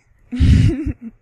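sudden loud laugh; loud laugh burst. Real, not acted. Reading a dirty word list, she bursts out laughing.

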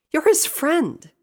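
high, loud, slow insistence; insistent. A narrator's reading. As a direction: press the point hard in a high voice. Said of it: "Rainbow insisted".

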